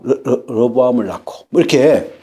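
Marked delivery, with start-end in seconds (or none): none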